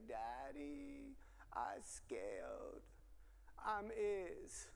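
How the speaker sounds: imitating a little boy, soft and babyish; scared toddler. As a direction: softly mimic a toddler's baby talk. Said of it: "he could barely speak".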